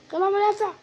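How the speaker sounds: high, loud call; urgent gameplay callout; excited shout. Real, not acted. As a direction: yell it to the team. Shouting defender out.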